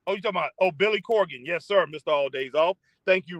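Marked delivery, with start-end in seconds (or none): none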